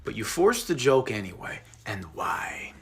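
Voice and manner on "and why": softer, breathy mock-grave whisper; ominous whisper. Interrogator whispering the death toll at Ben to guilt him. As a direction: drop to a grave hush.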